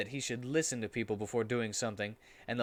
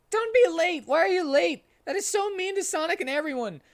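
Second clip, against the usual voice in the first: high, loud screaming tantrum; 7 dB louder than usual. A narrator's reading. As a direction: scream it at Shadow at the top of your voice. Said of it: "said Tails screamingly".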